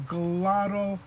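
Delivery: slow term. Shown as a demonstration. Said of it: "diacritical mark is called a".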